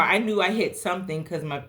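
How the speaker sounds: ordinary delivery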